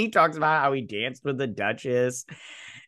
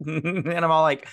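ordinary talk

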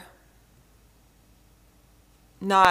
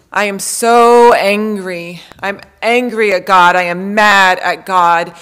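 loud, pained cry of anger at God; angry outburst; 11 dB louder than usual. Retold or imitated. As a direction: let the anger finally burst out loud. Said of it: "And the moment when she said".

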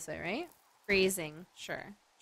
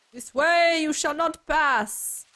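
loud, high, slow booming quote; mock-epic proclamation; 7 semitones higher, 6 dB louder, slower than usual. Streamer playfully quoting at a blocked path, mock-epic. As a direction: declare it like a grand guardian barring the path.

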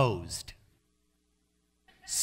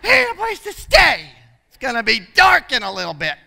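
much higher and louder, a half-laughing anxious plea; retold panic; 11 semitones higher, 9 dB louder than usual. Mission worker pleading anxiously to the older mentor, high and frantic. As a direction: squeal it like a flustered helper. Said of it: "I said".

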